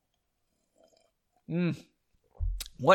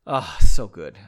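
much louder, low oh so good; savoring moan; 9 semitones lower, 12 dB louder than usual. Real, not acted. Host praising congealed popcorn, loud savoring groan. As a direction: groan it like the best bite ever.

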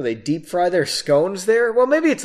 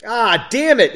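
higher, louder cry of annoyance; pained yell; 6 semitones higher, 6 dB louder than usual. A narrator's reading. Passenger yelling after slamming his head, pained. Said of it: "he yelled".

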